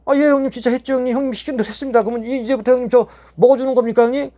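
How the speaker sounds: 높은 목소리로 신나서 전하기; 해냈다고 자랑하듯; 들떠서 보고하기. Retold or imitated. 형님에게 신나서 대답. (형님에게 높고 들뜬 목소리로 보고)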